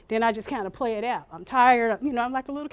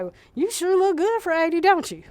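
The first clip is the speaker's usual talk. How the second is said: higher, bright reassuring compliment; cheerful agreement; 6 semitones higher than usual. Shown as a demonstration. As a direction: play along kindly. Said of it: "You can say".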